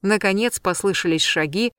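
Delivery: ordinary delivery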